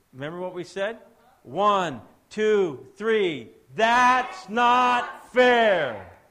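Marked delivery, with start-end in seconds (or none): 1.4-3.5 high, loud count for a crowd. Real, not acted. When I count to three. 3.7-6.2 very loud, high, each word stretched long; drawn-out mock complaint. When I count to three.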